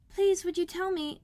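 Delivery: Alice timidly addressing the Duchess. Acted. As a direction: ask shyly and high. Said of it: "said Alice a little timidly".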